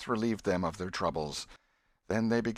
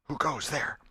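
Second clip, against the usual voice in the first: guarded voice; 8 semitones higher than usual. Acted. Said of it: "a guarded voice said".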